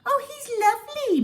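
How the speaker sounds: high, loud doting lady's voice; gushing high-pitched pet talk; Mrs Silver cooing. A narrator's reading. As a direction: coo at her pet like a doting owner. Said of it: "Missus Silver said".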